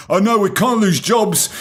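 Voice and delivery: high, whiny mock protest; mock whiny objection. Podcaster mocking job-loss worriers in a high, whiny mock voice. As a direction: sneer it like a pearl-clutching objector. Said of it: "mocking farmers' defenders".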